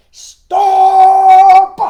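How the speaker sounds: long, high, full-force shout; commanding storybook yell. Acted. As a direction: bellow it as the chief frog, holding the vowel. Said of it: "the chief frog shouted".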